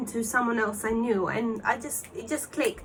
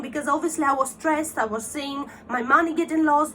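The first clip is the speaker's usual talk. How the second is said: ordinary delivery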